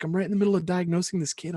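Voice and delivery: rushed wife explaining she is busy; Robin hurried. Retold or imitated. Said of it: "she's like".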